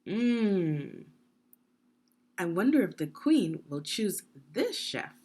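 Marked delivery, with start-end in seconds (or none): none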